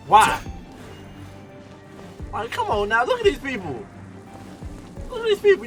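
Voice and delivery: usual speaking voice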